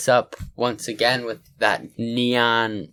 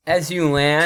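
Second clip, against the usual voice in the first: usual speaking voice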